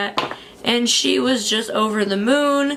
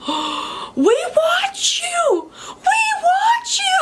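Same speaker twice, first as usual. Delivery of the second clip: excited squeal; slower than usual. Retold or imitated. Mother recognizing the vlogger, squealing with excitement. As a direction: squeal it like a thrilled fan spotting a star.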